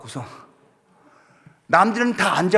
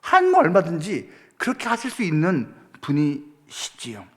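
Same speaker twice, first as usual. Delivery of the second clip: ordinary talk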